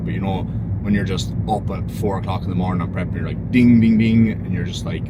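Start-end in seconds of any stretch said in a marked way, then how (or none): 3.5-4.3 loud, ringing imitation alarm bell; wide-awake dinging. Up at four in the morning on prep, wide-awake dinging. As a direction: punch out each ding like a bell.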